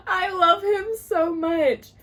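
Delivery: high, loud, slow declaration of adoration; gushing wail. Real, not acted. Reader declaring love for the hero, loud drawn-out gushing. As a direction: wail it out, stretching the words.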